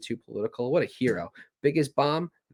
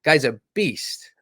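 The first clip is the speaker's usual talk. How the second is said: slow, emphatic praise; impressed emphasis; 5 dB louder, slower than usual. Retold or imitated. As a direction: draw out the praise with awe. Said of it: "I go, "This guy".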